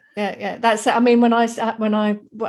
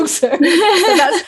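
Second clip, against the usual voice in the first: laughing; 7 semitones higher, 6 dB louder, slower than usual. Real, not acted. Laughs at own struggle with Danish.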